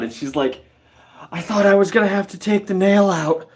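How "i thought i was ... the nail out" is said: high, fluttery nurse voice; flustered nurse. Retold or imitated. Nurse confessing she feared pulling the nail, high fluttery voice. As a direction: play the rattled nurse, pitched up.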